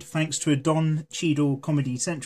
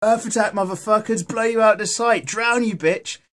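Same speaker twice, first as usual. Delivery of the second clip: louder, higher hammed-up cartoon battle cries; mock battle cries; 7 semitones higher, 6 dB louder than usual. Mocking the cartoon heroes to viewers, hammed-up shouted battle cries. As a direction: yell like kids in a cartoon fight. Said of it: "So everyone's like".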